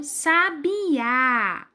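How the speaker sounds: Sabiá stretched slowly, weight on the final á; Sabiá in drawn-out syllables, stressed á; stress on the last syllable. Shown as a demonstration. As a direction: model Sabiá for a class to repeat, long final á. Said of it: "Vamos falar comigo?".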